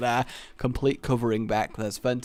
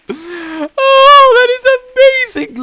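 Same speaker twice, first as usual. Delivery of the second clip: excited shout; 16 dB louder than usual. Real, not acted. Excited reaction.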